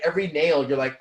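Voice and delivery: normal talking voice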